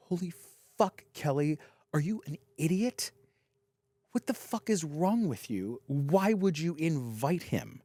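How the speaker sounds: hushed, mock-angry girl on a recording; a hint of an angry friend; retold angry girl. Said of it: "one of the girls go".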